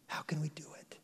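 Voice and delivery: hushed conspiratorial whisper; secret whisper. Retold or imitated. Leaders secretly plotting in back corners, whispering. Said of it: "secretly whispering in back corners".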